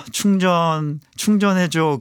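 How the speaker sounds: mock pleading. Acts out asking family for recharge.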